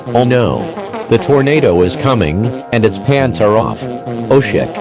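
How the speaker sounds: normal talking voice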